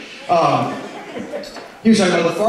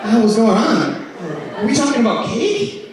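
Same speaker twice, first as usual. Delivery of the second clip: baffled; 7 semitones higher than usual. Retold or imitated. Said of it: "confused reaction to cake lady".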